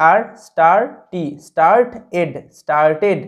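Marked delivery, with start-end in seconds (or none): 1.5-3.2 স্টার্ট, এড, then joined as স্টার্টেড; syllable-by-syllable demo. Shown as a demonstration. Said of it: "এরকমভাবে". (স্টার্ট আর এড ভেঙে, তারপর জুড়ে স্টার্টেড উচ্চারণ)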